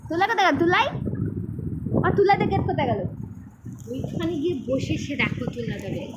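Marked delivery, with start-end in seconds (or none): 0.1-3.0 higher, louder calls searching for the cat; calling out. Real, not acted. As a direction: call out looking for the cat. Looking for the cat. (বিড়াল খুঁজতে জোরে ডাকা)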